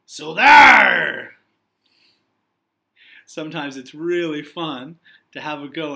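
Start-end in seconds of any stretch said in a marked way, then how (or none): 0.0-1.4 very loud booming pirate shout; scary pirate shout. Acted. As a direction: blast it out to scare the readers. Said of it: "let loose with a scary".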